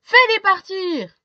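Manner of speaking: high, loud desperate cry; pleading shout. Acted. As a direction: beg loudly to be rid of it. Man begs to remove hair.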